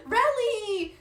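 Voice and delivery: loud, high shout of the name; delighted shout of a name; excited call-out. Real, not acted. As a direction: greet her like a long-lost friend. Greeting a chat friend.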